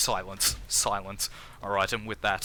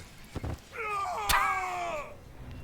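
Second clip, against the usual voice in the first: long high-pitched laugh. Real, not acted. Laughing at his own catchphrase, real high laugh. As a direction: crack up in a high, drawn-out laugh.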